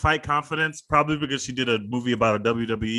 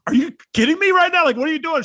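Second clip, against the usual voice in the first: high, loud exasperated shout; exasperated yell; 14 semitones higher, 6 dB louder than usual. Retold or imitated. ScarJo yelling at the crashing pilot, high and loud. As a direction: yell in disbelief at her partner. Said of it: "ScarJo's like".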